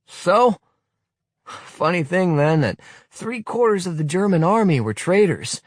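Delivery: scornful rebuke. Acted. Willy scolding the youth leader, scornful tirade. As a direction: tear into him with scorn.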